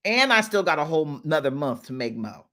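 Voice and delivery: usual speaking voice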